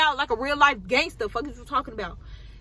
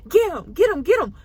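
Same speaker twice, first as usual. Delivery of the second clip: loud, higher mock yell urging the dog to attack; mom urging the dog; 8 semitones higher, 8 dB louder than usual. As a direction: egg the dog on, loud and high. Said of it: "she was telling him".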